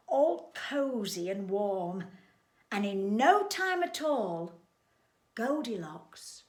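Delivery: usual speaking voice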